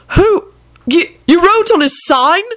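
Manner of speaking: very high, stammering outrage; angry yell. A narrator's reading. Neville turning on Lupin about the sign, angry yell. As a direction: squawk it in furious disbelief. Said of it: "Neville yelled angrily".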